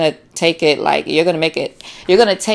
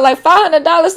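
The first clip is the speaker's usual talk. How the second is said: outraged disbelief; 12 semitones higher than usual. Pretend. Repeating the shocking request.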